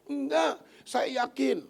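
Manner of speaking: put-on defensive young woman.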